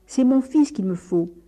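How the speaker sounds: loud, high insistence; father crying out his demand; indignant cry. A narrator's reading. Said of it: "cria le père".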